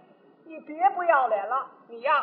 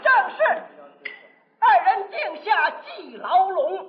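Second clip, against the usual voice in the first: slow opera recitation; 3 semitones higher, 4 dB louder, slower than usual. Acted. Closing couplet, opera recitation.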